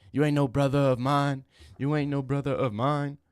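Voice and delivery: mock dramatic disowning. Streamer mock-disowns a chatter who calls him twin, loud. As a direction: declaim it like a soap opera.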